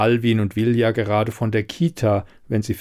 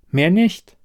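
high-pitched, rising MEHR NICHT; higher-voiced protest ending on a rise; insistent assurance; 8 semitones higher, 4 dB louder than usual. A narrator's reading. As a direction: lift "Mehr nicht" upward, eager to reassure. She insists, he echoes sceptically.

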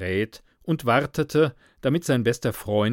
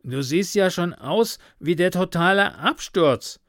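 higher-pitched, mildly alarmed remark; worried, raised pitch; uneasy; 7 semitones higher than usual. A narrator's reading. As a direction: voice concern for a struggling friend. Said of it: "fügte er beunruhigt hinzu".

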